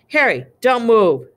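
loud, high-pitched command; sharp order. A narrator's reading. Uncle orders boy not to move.

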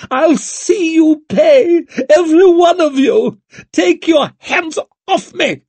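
high, loud screamed threats; screaming. A narrator's reading. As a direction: scream furiously while fighting off guards. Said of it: "Lady Wetterland was screaming".

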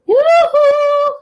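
high, loud yoo-hoo; calling out. Acted. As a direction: sing it out across the meadow. Witch calls to the cow.

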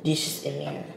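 mocking commenter voice. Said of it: "talking about some".

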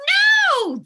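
very high, loud stretched no; children yelling. A narrator's reading. Children stopping the melting snowman, high loud yell. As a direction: yell it high like alarmed kids. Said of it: "The children yelled".

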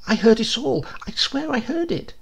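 high, earnest insistence; a hint of the indignant old postman; lightly voiced old man. A narrator's reading. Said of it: "The old man asked".

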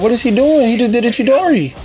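surprised. Real, not acted. Surprised by opponent's move.

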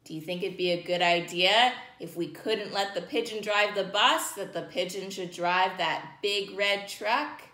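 usual speaking voice

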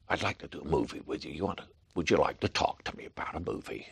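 soft, low American director; quiet, courtly invitation; John Huston impression. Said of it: "That sounds like John Huston".

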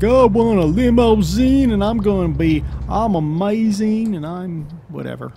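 high, slow, whiny mock brat; petulant brat. Driver mocking limo passengers, petulant little brat voice. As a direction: whine it like a spoiled little brat. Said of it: "petulant little brats who are like".

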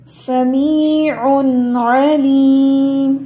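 समीउन with a clear noon, no hiding, very slow; no ikhfa. Shown as a demonstration. Teacher correcting ikhfa into clear noon. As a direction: say समीउन with the noon fully pronounced. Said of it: "ये हमने कोई इख्फा नहीं करना".

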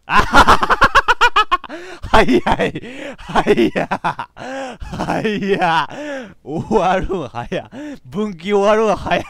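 loud, high, helpless laughter through the words; cackling in disbelief; real laughing fit. Real, not acted. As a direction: gasp it out while cracking up. Cracks up at abrupt branch ending.

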